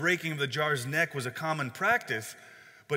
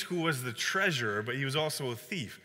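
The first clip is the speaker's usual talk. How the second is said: ordinary talk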